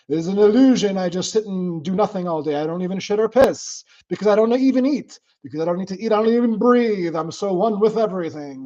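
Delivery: hammed-up spiritual zealot, pitched high; super-detached non-dualist. Streamer mocking extreme spiritual detachment, hammed-up non-dualist. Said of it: "I could be a super uber non-dualist".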